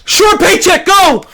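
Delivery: high, loud, half-yelled mock rookie rushing in; frantic rookie fighter. Mocking hungry Road to UFC rookies to viewers, mock frantic yell. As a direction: ham up a desperate rookie charging forward. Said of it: "They're like".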